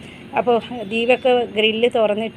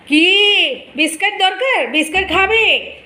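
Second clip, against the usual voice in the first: much higher, slow sing-song coaxing; coaxing pet talk; 7 semitones higher, 3 dB louder, much slower than usual. Real, not acted. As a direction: lure a stray dog with a biscuit. Coaxing the stray dog.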